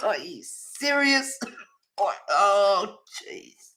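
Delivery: slow, high, laughing through it; laughing disbelief. Retold or imitated. Reacting to the woman's claim, laughing in disbelief. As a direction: ask it while cracking up. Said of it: "And it's like".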